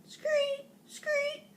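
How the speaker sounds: very high, thin screech; piercing little squeals without much volume; frog screech. A narrator's reading. Frog bashing the bag, screaming.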